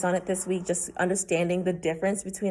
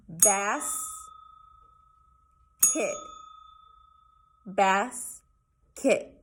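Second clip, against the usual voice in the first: split into syllables; 4 dB quieter, much slower than usual. Shown as a demonstration. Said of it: "I'm gonna say it".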